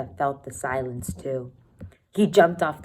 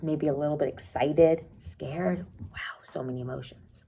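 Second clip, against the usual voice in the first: usual speaking voice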